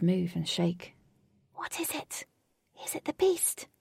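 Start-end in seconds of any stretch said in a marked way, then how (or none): 1.5-3.7 put-on high, hushed girlish whisper for a character. A narrator's reading. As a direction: whisper it high and wary, as Jane. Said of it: "Jane whispered".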